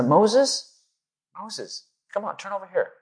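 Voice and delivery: a lazy, easygoing call to Moses; lightly voiced relaxed God; leisurely God. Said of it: "real leisurely".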